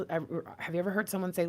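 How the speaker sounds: ordinary talk, nothing marked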